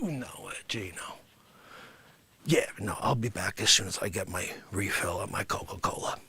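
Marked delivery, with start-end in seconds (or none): 3.0-6.2 unvoiced whisper, low; whispered aside on the phone. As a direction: whisper it on the side.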